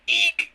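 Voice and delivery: high squeak of fright; startled eek. Acted. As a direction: yelp like a startled mouse.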